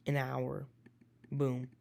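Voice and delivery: ordinary delivery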